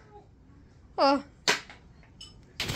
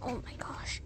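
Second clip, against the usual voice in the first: whisper; 11 dB quieter than usual. Real, not acted. Hiding from the seeker.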